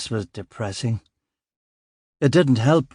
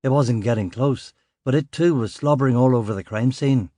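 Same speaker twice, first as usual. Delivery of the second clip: ordinary delivery, nothing marked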